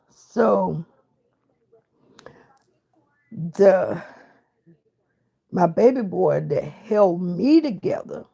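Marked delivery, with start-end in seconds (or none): none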